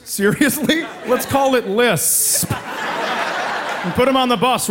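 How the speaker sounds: slow, incredulous mock rant; lightly hammed-up sarcasm; mock outrage. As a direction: drag out the words in disbelief. Mocking the namer.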